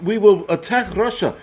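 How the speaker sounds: high, put-on Ukrainian accent; mock Ukrainian accent. Streamer mocks a leader on world stage, Ukrainian accent. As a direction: declare it as a parody Ukrainian leader. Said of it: "his Ukrainian accent".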